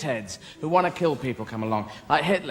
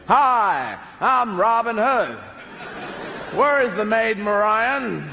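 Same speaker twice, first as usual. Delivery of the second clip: put-on American accent; 3 semitones higher, much slower than usual. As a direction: drawl it like a Hollywood American hero.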